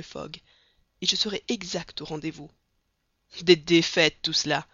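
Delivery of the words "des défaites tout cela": loud scornful outcry; exclaiming. A narrator's reading. Colonel Proctor scoffs at Fogg, a loud exclamation. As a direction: burst out loud at the whole affair. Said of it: "s'écria Stamp W Proctor".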